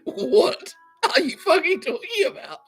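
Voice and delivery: high, slow, laughing through the line; laughing mock outrage as the King; mock King Charles. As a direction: squeeze it out while cracking up. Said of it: "King Charles went".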